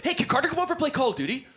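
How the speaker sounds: put-on high, quick child's voice; high-voiced kid asking a favor; kid on the street. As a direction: pitch it up like an eager little kid.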